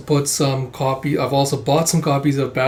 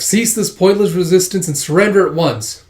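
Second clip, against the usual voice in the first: raised, louder command; official police demand; 5 semitones higher, 5 dB louder than usual. Acted. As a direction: order surrender with authority. Police order hijackers to surrender.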